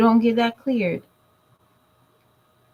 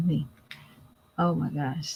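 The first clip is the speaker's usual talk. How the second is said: ordinary talk, nothing marked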